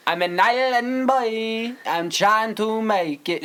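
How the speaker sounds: high, slow mock Island Boy singsong. Host mocking the Island Boys with a high, slow drawl. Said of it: "Just like the Island Brothers".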